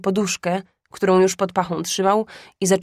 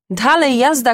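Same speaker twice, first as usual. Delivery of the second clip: put-on boy's excited shout, higher and louder; 5 semitones higher, 5 dB louder than usual. A narrator's reading. Felek shouting to his brothers, eager. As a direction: call the boys to go. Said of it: "krzyknął Felek".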